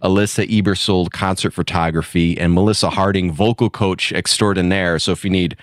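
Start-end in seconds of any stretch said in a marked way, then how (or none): none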